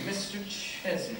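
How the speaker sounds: soft, absorbed murmur; echoing while writing. Acted. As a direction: say it softly as you write it down. Echoes dictation while writing.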